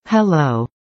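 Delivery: usual speaking voice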